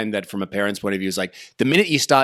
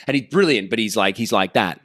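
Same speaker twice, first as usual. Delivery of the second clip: normal talking voice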